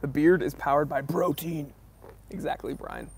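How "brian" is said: usual speaking voice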